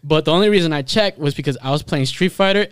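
ordinary delivery

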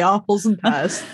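slow, drawn-out Cockney rhyming slang. Acted. Co-host quoting what the Cockneys would say, mock Cockney. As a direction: put on a Cockney voice, stretching the vowels. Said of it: "as the Cockneys would say".

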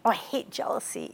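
breathy whisper under the breath; a mock-disgusted whisper; whispered aside. Real, not acted. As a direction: hush it like a secret. Aside to the audience.

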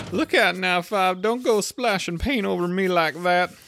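high, loud irritated gardener; a high, testy workman snapping at his mate; gardener's complaint. Acted. Gardener warning Five off, high and irritated.

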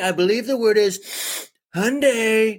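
high, put-on politician voice; drawn-out, hammed-up presidential impression; Donald Trump. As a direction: pronounce it like a pompous president. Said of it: "We'll do it in Trump".

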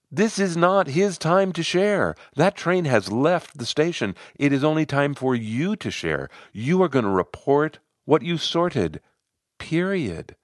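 insistent, no room for argument; firm correction. A narrator's reading. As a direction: lay down the rule point by point. Narrator firmly corrects Brian's open question.

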